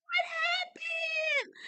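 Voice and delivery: high, slow, drawn-out cry; Kimmy rushing out confused; startled Kimmy. Retold or imitated. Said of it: "She's like".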